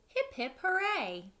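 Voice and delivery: excited hip hip hooray; cheer. Acted. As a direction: cheer for the child. Cheering in story.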